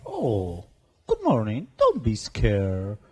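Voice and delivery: put-on hams up a friendly greeting to the frightened children; eager adventurer in character. Player speaks in character.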